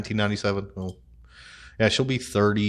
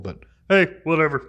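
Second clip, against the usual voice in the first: normal talking voice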